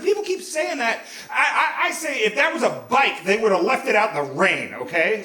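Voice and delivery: loud, high-pitched self-justifying; indignant. Retold or imitated. Teenager arguing with dad over the camera, indignant. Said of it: "some indignant shit like that".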